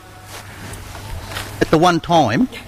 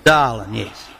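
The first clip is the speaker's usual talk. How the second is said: "Darling" dropped very low as a cue; low spoken cue; 10 semitones lower, slower than usual. Shown as a demonstration. Leader cueing the audience in, low voice. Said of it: "sweet, angelic, and all in time".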